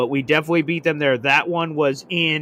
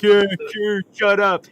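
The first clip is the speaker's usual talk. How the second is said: higher, louder scoffing retort; mock dismissive jeer; 6 semitones higher, 5 dB louder than usual. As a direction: jeer like a rival fan brushing you off. Cowboys fans dismissing his prediction.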